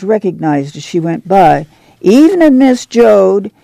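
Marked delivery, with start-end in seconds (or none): 2.0-3.5 neighbor greeting. A narrator's reading.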